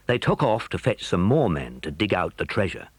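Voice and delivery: plain reading voice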